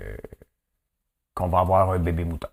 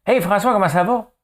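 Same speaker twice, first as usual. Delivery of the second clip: cheery greeting; 13 semitones higher, 7 dB louder than usual. Retold or imitated. Old acquaintance calling him up.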